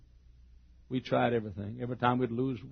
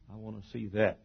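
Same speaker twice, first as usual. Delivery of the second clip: ordinary talk, nothing marked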